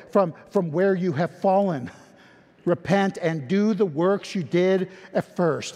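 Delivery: ordinary talk, nothing marked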